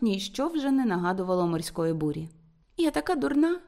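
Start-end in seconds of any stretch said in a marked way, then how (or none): 2.8-3.6 high-pitched pitiful confession; plaintive. A narrator's reading. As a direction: lament it pitifully in a raised pitch. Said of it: "жалісно промовила вона".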